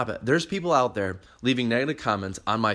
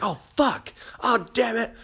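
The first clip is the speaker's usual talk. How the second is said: mock blooper outburst; 10 semitones higher, slower than usual. Professionals' blooper reactions.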